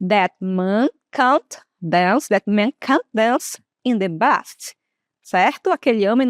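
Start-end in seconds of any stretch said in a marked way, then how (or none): 0.1-4.8 "dance" and "bath" with a long open A, drawn out slowly; long British A. Shown as a demonstration. Teacher to students, demoing the long A, slowly. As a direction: stretch the A in "can't", "dance", "bath". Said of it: "a forma mais longa de falar".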